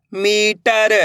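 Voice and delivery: मीटर with मी held long; teacher's model reading of मीटर, stretched first syllable. Shown as a demonstration. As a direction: draw out the ee in मीटर. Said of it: "ये देखना है कि मैं किस तरीके से".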